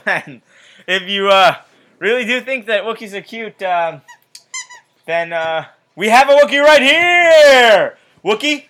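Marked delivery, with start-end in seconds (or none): 5.9-8.6 slow, loud, high showman reveal; excited reveal. Pretend. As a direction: present it like a big surprise. Mock-revealing a Wookiee guest.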